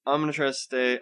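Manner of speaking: ordinary talk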